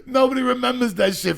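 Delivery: high, loud laugh-through exclamation; laughing exclaim. Real, not acted. Telling the co-host about beach syringes, laughing loudly. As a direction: blurt it out while laughing.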